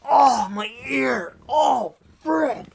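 high, slow, loud pained wail; buddy in pain. Retold or imitated. Hunting buddy wailing about his ringing ear, high and loud. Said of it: "he said that".

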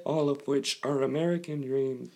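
evenly spaced machine words, repeated identically; robot voice. Acted. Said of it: "that's what a robot would sound like".

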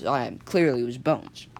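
usual speaking voice

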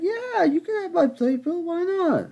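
falsetto imitation of a breezy lady, high and loud; mock lady voice. Lady next to him handing over her playbill, mock falsetto. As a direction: squeak it out like the cheerful lady. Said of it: "She's like".

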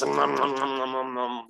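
imitated greedy chomping noises; Cookie Monster munching. Demon feeding on pain and shame, cartoonish munching. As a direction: chomp through a run of noms. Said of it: "like Cookie Monster".

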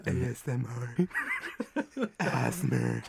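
low, hushed ASMR parody; playful fake ASMR voice; mock ASMR. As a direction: drop low and soft into the mic. Mock ASMR after "Go lower.

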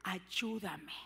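a hint of a Canaanite woman pleading. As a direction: beg like a desperate mother. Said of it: "le dijo".

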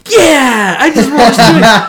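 loud, high victory cheer; triumphant grandma. Retold or imitated. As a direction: whoop it like a grandma celebrating.

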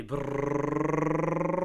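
long rolled-tongue drum roll. Pretend. Host before a grand reveal, mock drum roll. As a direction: trill a sustained drum roll, rising slightly. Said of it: "Are you ready?".

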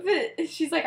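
normal talking voice

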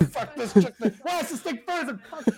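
high, hammed-up cursing fit; mock frantic fury at a stubborn thing; exasperated waiter losing it out of sight; backroom rage. Waiter's acted backroom outburst.

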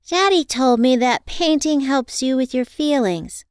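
put-on high earnest child voice; little girl. A narrator's reading. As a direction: speak it like a small girl.

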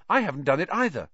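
high, quick and eager; bright. A narrator's reading. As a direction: chime in brightly at a fast clip. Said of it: "said Neville brightly".

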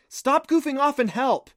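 high, loud, scolding command; sharp order. A narrator's reading. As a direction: shout at a friend to quit playing around. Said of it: "Clancy ordered".

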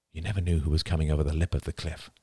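usual speaking voice

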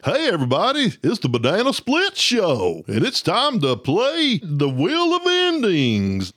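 big cartoon Southern accent; Banana Splits lion, strong Southern accent. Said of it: "The lion has a strong Southern accent".

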